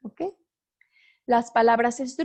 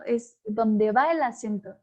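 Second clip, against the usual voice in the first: usual speaking voice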